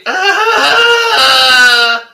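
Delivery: loud, high drawn-out mock wail; hammed-up crying howl. Friend imitating the caught-out man, exaggerated wail. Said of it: "And then he's like".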